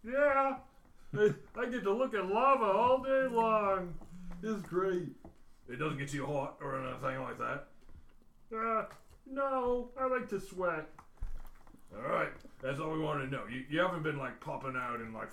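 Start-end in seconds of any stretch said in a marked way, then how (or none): 0.0-5.1 higher, louder villain voice; a cheerful caged supervillain; Bull's voice. Acted. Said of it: "Hey, this is Bull". 8.5-10.9 a cheerful caged supervillain; Bull's voice. Acted. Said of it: "Hey, this is Bull".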